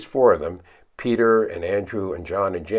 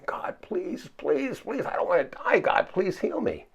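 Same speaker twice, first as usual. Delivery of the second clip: desperate prayer; 10 semitones higher, 3 dB quieter than usual. Pretend. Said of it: "I'm sure I would say".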